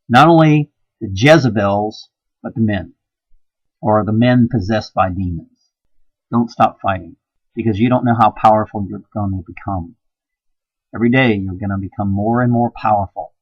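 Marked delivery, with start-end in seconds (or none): none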